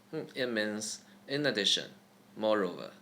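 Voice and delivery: usual speaking voice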